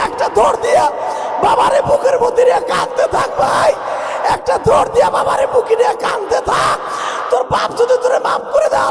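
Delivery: very high, loud urgent exhortation; full-force preaching. Real, not acted. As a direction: whip the crowd into tears.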